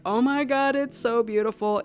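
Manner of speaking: choked-up awe. Retold or imitated. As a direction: gush as if moved by beauty. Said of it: "It had me choked up".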